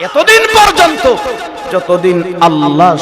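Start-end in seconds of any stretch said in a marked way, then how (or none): 0.1-1.0 very high sermon cry; preaching at full force. Acted. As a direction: lift it to the crowd at full pitch.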